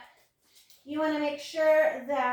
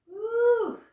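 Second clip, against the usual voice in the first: excited cheer; 8 semitones higher, 5 dB louder than usual. Real, not acted. Coach cheering class on.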